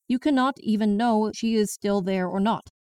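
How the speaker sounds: plain reading voice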